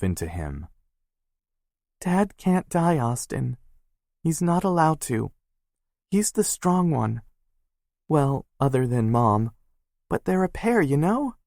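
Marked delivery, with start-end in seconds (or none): 2.0-11.4 much higher, pleading, near tears; cling to him and fight the tears; crying sister scared for her father; tearful sister. Acted. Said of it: "Maya hiccuped a sob".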